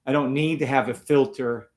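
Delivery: normal talking voice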